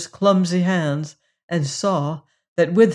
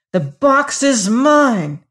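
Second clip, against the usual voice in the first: higher, louder and slow, furious; slow furious outburst, higher and louder; enraged claim; 7 semitones higher, 7 dB louder, slower than usual. A narrator's reading. Servant furious at Hagar over the casket, loud and slow.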